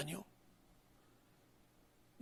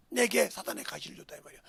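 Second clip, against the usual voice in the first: ordinary talk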